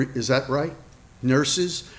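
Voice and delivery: ordinary delivery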